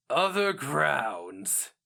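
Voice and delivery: slow, scornful barked words; derisive bark. A narrator's reading. Turturga scoffs at the idea of other grounds, derisive bark. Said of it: "with a derisive bark".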